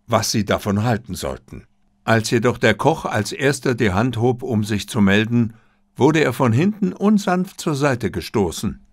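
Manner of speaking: ordinary delivery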